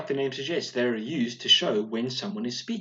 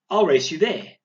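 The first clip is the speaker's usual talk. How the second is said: eager call; 6 semitones higher, 10 dB louder than usual. Shown as a demonstration. Teacher reads example of girl racing.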